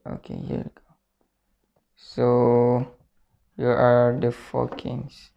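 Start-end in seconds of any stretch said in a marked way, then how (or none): 0.1-0.8 hushed, under the breath; whispered aside. Real, not acted. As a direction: whisper it to yourself. Quiet aside after missing king.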